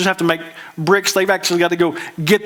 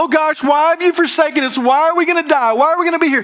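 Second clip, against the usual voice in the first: higher, imitating a frightened wail; hammed-up despair in a much higher voice; mock panicked cry; 10 semitones higher, 3 dB louder, faster than usual. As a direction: whine it out like a scared crowd. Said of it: "fear would make us cry out and go".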